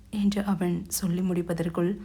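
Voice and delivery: ordinary delivery, nothing marked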